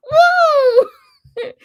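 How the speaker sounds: long, ringing "woo" of delight; high loud whoop. Retold or imitated. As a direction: let out a big high whoop. Retold reaction to flattering remark.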